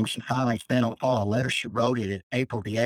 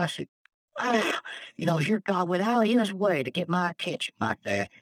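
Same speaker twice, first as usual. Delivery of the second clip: high, choked-up testimony; emotional; 6 semitones higher than usual. Real, not acted. Man testifying how God reached him, emotional. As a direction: voice lifting as real emotion wells up. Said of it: "I get emotional just thinking about this".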